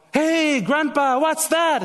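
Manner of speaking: put-on high, eager child voice asking grandpa; curious grandchild. Grandchild asking grandpa about old photos, high and eager. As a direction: play the curious kid, pitched well up.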